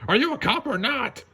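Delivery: high put-on voice, demanding; shopkeeper voice. Merchant demanding the bunny prove she's a cop, high voice. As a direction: challenge her like an impatient merchant. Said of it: "a local merchant shouted".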